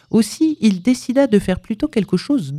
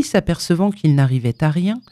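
ordinary delivery

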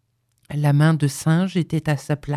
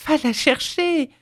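high, breathless plea; panting; 11 semitones higher than usual. A narrator's reading. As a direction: urge him while catching your breath. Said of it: "reprit-elle d'une voix haletante". (voix haletante)

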